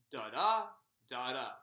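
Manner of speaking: "Da da" with the second da stressed; iambic beat. Shown as a demonstration. Teacher showing students how the meter goes, iambic beat. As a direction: punch the second beat of each "Da da". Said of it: "It goes kinda like this".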